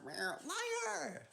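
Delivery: very high-pitched playful cry; mock accusation. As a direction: squeal it as a pretend accusation.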